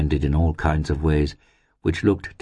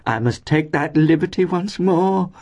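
very high, effusive landlady voice; rapturous landlady about to embrace; gushing fondness; 13 semitones higher than usual. A narrator's reading. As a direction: pipe it up in overflowing affection. Landlady gushes, about to embrace girls.

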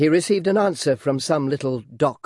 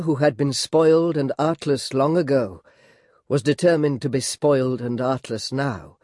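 ordinary delivery

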